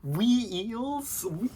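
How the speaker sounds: high disbelieving wee-eels?; wee-eels repeated in shock; incredulous. Real, not acted. Incredulous repeat of kid's word.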